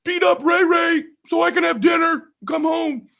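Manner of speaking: put-on very high shouted child voice; boy explaining to the neighbor why he came, high shout; little boy shouting. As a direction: yell it up at the big neighbor like a kid. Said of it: "I go".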